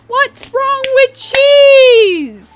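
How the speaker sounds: high, drawn-out loud shriek over cheese; mock outraged wail. Streamer defending cheese to a viewer, mock outraged shriek. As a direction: wail it, stretching the last word right out.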